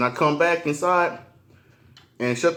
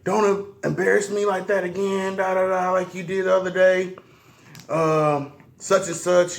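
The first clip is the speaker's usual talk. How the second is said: lightly voiced scolding man; 6 semitones higher than usual. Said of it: "Motherfucker was like".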